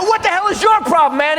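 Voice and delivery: high, agitated confrontation; exasperated demand. Acted. Comedian confronts his laughing colleague backstage, exasperated yell.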